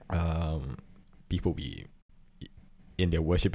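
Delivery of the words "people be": usual speaking voice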